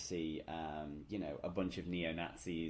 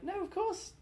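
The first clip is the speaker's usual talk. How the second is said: higher-pitched retold insistence; mock disbelief; 7 semitones higher, 3 dB louder than usual. Podcaster recalling being sure of the actor's nationality, mock disbelief. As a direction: lightly act out your own disbelief.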